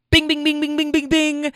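very high, chiming bing bing like a jackpot bell; mock bell ding. Host celebrating the caller's great line with a very high bell chime. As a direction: ring it out like a game-show winner bell.

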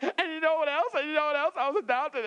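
high, loud, hammed-up blubbering; mock sobbing confession. Applicant blubbering his life story to interviewer, hammed-up crying. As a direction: overshare through fake tears. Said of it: "I mean, I'm crying".

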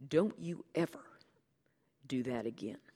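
whispered stern warning; hushed warning. Acted. Narrator scolds Fletcher.

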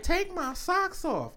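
high, soft, drawn-out mimicry; imitated woman. Man retelling a partner's request, imitated woman. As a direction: imitate her slowly in a high voice.

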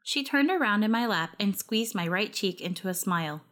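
plain reading voice

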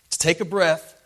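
a heaving, loud gasp; forceful breath. Shown as a demonstration. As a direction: drive the words out hard. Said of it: "pushing against his feet".

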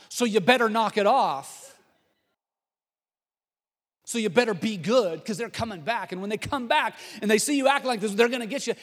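loud, high scolding threat; hammed-up menacing telling-off; threatening warning. Shown as a demonstration. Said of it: "that's not the way it's, it's said".

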